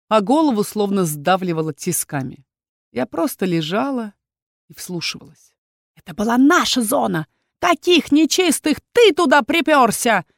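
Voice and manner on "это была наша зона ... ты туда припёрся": furious whisper. Acted. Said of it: "яростно шептал боевик".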